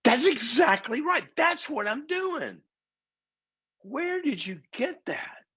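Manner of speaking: very high, slow and wide-eyed; amazed woman. Retold or imitated. Woman on plane amazed by proverb, very high slow voice. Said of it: "She goes".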